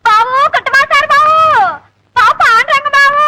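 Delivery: high-pitched alarmed hollering; panicky, high calls for help; calling for help. Acted.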